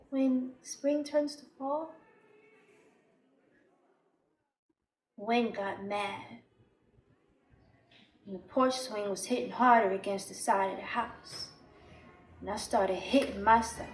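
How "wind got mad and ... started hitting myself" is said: low, soft, slowing down; ominous hush. Acted. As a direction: let it turn dark and quiet. Dark turn toward self-harm.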